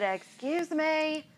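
drawn-out, high-pitched "excuse me"; insistent repeat. Retold or imitated. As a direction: stretch it out slowly and high, insisting. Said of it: "I said".

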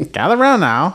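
put-on brassy old-time announcer calling a crowd; 1940s newsreel voice. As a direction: hawk it like a 1940s newsreel barker. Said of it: "newsreel voice".

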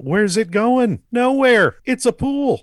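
high-pitched comic indignation; emphatic insistence. Real, not acted. Arguing about gore in the pool, high emphatic insistence. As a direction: push the voice high to make the obvious point.

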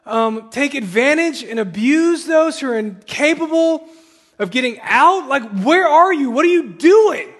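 loud, high, urgent plea to God; pleading lament prayer. Acted. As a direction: pour out a desperate complaint, loudly. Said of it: "Do you pray like that?".

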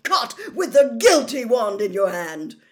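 loud, high accusing roar. A narrator's reading. Mister Diggory accusing the elf caught red-handed, roaring. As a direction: thunder the charge at the culprit. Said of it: "Mister Diggory roared".

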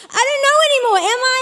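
high, whiny put-on near-crying; mock tearful. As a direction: act on the verge of tears, hammed up. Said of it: "you wanna start crying".

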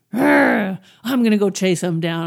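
loud growled outburst, hammed-up anger; mock road rage. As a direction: roar like an enraged driver. Acts out a road-rage reaction.

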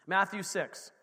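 normal talking voice

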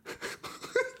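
breathy high laugh; high giggle. Real, not acted. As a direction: giggle at your own joke. Laughing at own joke. (hohes Kichern)

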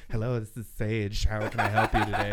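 low, put-on receptionist greeting; phone alias voice. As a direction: answer the prank-style call in disguise. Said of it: "it's with a different voice".

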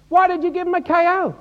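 high, loud mock outrage; indignant bystander. Somebody challenging the lifesaver, high loud indignation.

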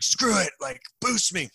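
high-pitched reckless cry; imitating a bold call for a leg-up; gung-ho resolve. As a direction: blurt it like a drunk daredevil. Teller's own bold decision acted.

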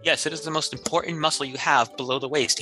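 usual speaking voice

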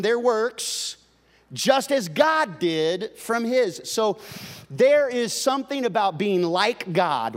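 loud, high scripture reading, slowed down; preaching at full force. Acted. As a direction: proclaim the verse to the whole church. Preacher reads Hebrews chapter four.

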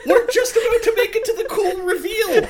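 high, loud, bursting with laughter; can't keep a straight face; laughing while talking. Real, not acted. Laughing after awkward silence.

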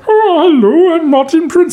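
very high-pitched cartoon character voice; put-on falsetto, a rough cartoon imitation; Martin Prince. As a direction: squeak it out as an admittedly inaccurate impression. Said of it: "Martin has got a really high voice".

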